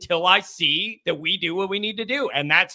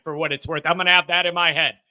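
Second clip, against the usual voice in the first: ordinary delivery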